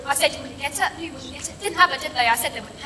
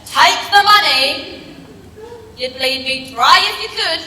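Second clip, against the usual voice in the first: insistent shout; 4 semitones higher, 8 dB louder than usual. Retold or imitated. Gran forcing a tenner on the granddaughter, loud and bossy. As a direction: bark it like a stubborn granny.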